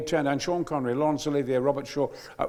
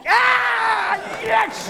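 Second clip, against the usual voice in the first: delighted shout; 7 dB louder than usual. Retold or imitated. Director delighted at the reading.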